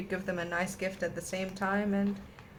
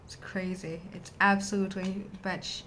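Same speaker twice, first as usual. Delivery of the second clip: usual speaking voice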